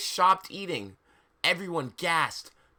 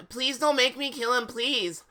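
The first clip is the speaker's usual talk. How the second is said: higher-pitched, mock-desperate plea; begging; 7 semitones higher than usual. Ebony begging not to kill, high pleading. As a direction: beg in a raised but natural voice. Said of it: "I begged".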